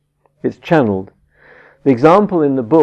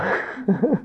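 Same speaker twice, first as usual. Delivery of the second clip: soft, high chuckle; laugh; 5 semitones higher, 7 dB quieter than usual. Real, not acted. Teacher amused after pleading with an imagined child, chuckling. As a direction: chuckle softly at your own plea.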